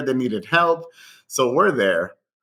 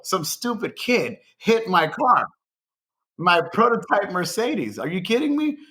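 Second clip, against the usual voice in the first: slow, high-pitched mock outrage; acted exasperation, rising high at the end; retold indignation; 6 semitones higher, slower than usual. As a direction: draw it out, incredulous, imitating an aggrieved boss. Chef's retold complaint about crashed car.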